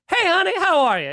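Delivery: high, honeyed phone greeting; sweet woman's voice. Retold or imitated. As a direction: greet the caller sweetly in a put-on voice. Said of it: "to illustrate how sweet she was".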